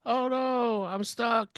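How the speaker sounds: high-pitched mock damsel voice; put-on high plea; stuck damsel. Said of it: "she's like".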